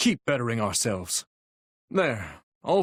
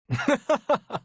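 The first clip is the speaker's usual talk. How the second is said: bright high laugh; a sudden amused laugh; short laugh; 11 semitones higher than usual. Acted. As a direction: let out a quick amused laugh. Character laugh voice line.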